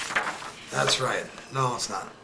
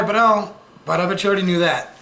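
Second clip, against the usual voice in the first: ordinary delivery, nothing marked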